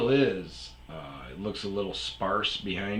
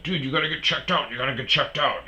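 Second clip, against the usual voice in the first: higher, louder, quick insistence; mock urging; 7 semitones higher, 5 dB louder, much faster than usual. Friend urging his buddy to get checked out, insistent. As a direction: nag a pal urgently, as retold. Friend urged to see a doctor.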